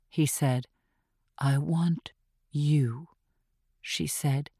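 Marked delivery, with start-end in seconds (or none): 1.4-3.1 lightly voiced killer, slow and low; seductive killer. A narrator's reading. Gretchen teasing Archie on the phone, slow and low. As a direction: purr it slowly, a hint of menace.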